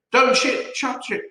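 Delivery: high, sharp warning cry. A narrator's reading. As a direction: stop him before he touches it. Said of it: "cried Missus Twit".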